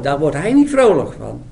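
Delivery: normal talking voice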